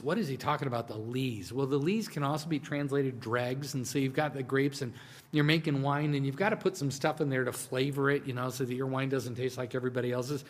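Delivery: ordinary talk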